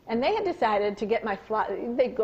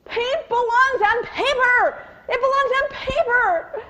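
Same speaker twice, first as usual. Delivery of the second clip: high, loud, slow shouted instruction; yelling the rule; 13 semitones higher, 7 dB louder, slower than usual. Retold or imitated. Mother finding paint on the wall, a loud slow shout. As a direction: shout what needs doing. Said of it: "She said".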